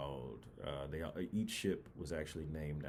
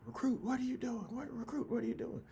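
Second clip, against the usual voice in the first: much higher, louder mock shout; imitated drill instructor yell; 4 dB louder, faster than usual. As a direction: squawk it like a furious drill sergeant. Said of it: "while everyone was getting yelled at".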